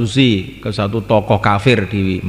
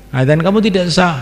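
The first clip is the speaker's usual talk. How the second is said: ordinary talk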